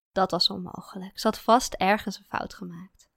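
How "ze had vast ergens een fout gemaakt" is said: ordinary delivery, nothing marked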